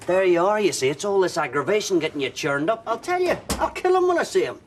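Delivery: loud, high furious rant; angry outburst. Acted. Husband to wife about their son, loud and furious. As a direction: blow up at the absent son.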